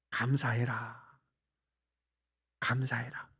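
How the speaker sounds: very soft and low, as a gentle inner prompting; hushed inner voice. Retold or imitated. Preacher voicing God's quiet word to him, hushed and low. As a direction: murmur it softly, like God speaking within.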